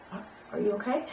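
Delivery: soft, stammering, horrified nurse; hesitant nurse. Retold or imitated. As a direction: stammer it quietly, shaken. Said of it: "and she's like".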